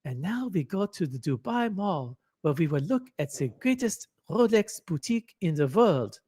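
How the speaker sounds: mock YouTube host with a German accent. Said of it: "I could do a Dubai expat accent".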